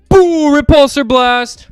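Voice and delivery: ordinary delivery, nothing marked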